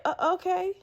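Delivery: small, unsure okay; hesitant reply. Retold or imitated. Stunned manager's hesitant reply.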